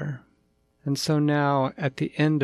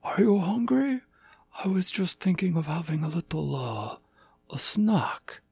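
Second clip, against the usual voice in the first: tiger king voice; 6 semitones higher than usual. Acted.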